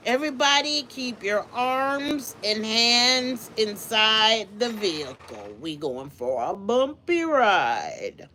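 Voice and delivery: slow spoof safety announcement; ride announcer. Pretend. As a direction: stretch it out like a fairground ride operator.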